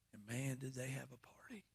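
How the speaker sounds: hushed aside after the shouting; whispered punchline after the climax. Real, not acted. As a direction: drop to a whisper for effect.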